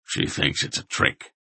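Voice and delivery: put-on low, hushed old man's voice; soft, low confidential report; quiet. A narrator's reading. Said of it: "the old man told them quietly".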